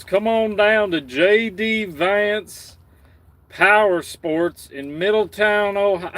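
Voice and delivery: put-on Southern accent. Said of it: "work on my Southern accent".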